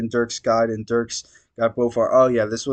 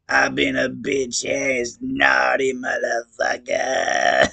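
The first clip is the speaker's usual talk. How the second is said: slow, shouted sobbing wail; wailing clown confession; much slower than usual. Acted. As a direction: blubber it out like a guilty clown. Gamzee's tearful confession.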